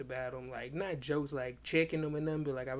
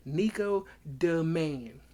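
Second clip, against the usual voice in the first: "Nico the Man" drawn out slowly and louder; emphatic name; 5 dB louder, slower than usual. Retold or imitated. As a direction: stretch each word of "Nico the Man". Drilling the name into his friend.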